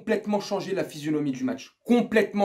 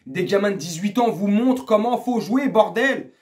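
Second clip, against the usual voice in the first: exasperated repeat. Real, not acted. Fan scolding players shown up by kids, exasperated. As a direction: drive the point home, fed up.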